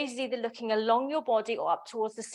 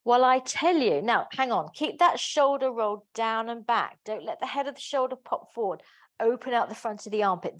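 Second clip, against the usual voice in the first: normal talking voice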